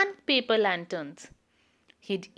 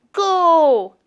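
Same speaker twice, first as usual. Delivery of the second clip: loud, higher shout of go; called out; 5 semitones higher, 9 dB louder than usual. Acted. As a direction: call the start loudly. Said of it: "they called to Jolly".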